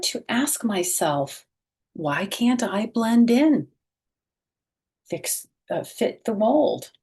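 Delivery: bright, expressive picture-book read-aloud; reading to children. A narrator's reading. Teacher reading a picture book aloud to children, expressive. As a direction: read it slowly and warmly to young listeners.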